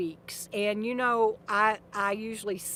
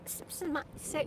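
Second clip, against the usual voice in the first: ordinary delivery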